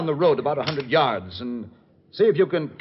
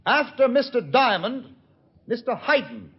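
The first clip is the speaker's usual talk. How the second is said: calling out; 12 semitones higher, 4 dB louder than usual. Acted. Shouting orders to distant henchman.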